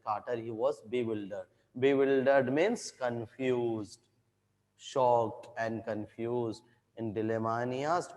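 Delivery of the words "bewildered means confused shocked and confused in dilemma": slow, careful explanation; slow explaining. Shown as a demonstration. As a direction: spell it out slowly for students. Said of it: "teacher explains a word slowly".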